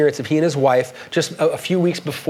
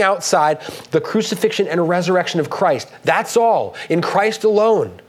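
impassioned preaching; 5 semitones higher than usual. Acted. Reads quote with rising fervor.

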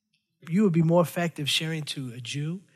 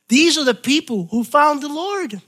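much higher and louder preaching cry; fervent preacher raising his voice on the key line; preaching climax; 10 semitones higher, 6 dB louder than usual. Real, not acted. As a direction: lift it up to the rafters.

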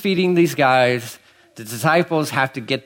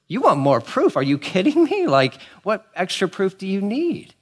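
high-pitched, questioning disbelief; incredulous; 6 semitones higher than usual. Acted. Preacher answering the Pharisees' demand for more proof, incredulous. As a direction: throw the demand back in rising disbelief.

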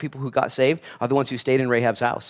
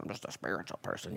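faded mock spiritual type, much softer, lower, almost breathed; 9 semitones lower, 18 dB quieter than usual. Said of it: "there's just spirituality".

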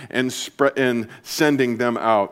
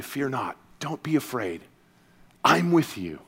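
hushed, much softer reassurance; gentle voice; 2 semitones lower, 8 dB quieter than usual. Acted. Jesus comforting a fearful listener, hushed and gentle. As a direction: soothe a frightened listener softly. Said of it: "he's telling you in a gentle voice".

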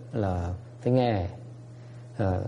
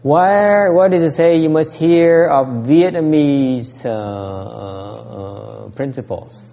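rhetorical challenge; 5 semitones higher, 7 dB louder, much slower than usual. Acted. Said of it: "rhetorical challenge to audience".

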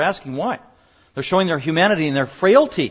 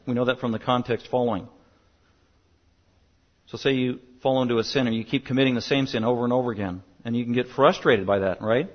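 usual speaking voice

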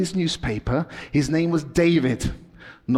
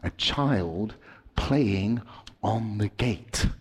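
tense story, pitch climbing as the bus speeds; dramatic storytelling; 5 semitones higher than usual. Acted. Dramatic story told to kids.

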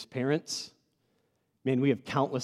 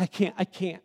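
mock tearful protest, pitched higher; imitated crying child; 7 semitones higher, 4 dB louder than usual. Nervous daughter before soccer, imitated tearful refusal. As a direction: imitate a daughter pleading through tears. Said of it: "And she's crying".

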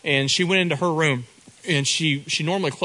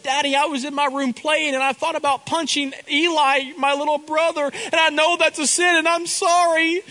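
put-on high, earnest small-girl voice; little girl confessing; 12 semitones higher than usual. Daughter confesses to daddy she thought of punching her brother, in a high earnest child voice. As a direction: sound like a contrite five-year-old.

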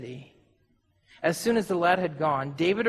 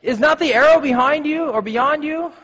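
higher, louder call across a field; cried out; 8 semitones higher, 8 dB louder than usual. A narrator's reading. Jonathan signals to David in hiding, calling out loud. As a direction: call out after the running boy. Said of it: "Jonathan cried out".

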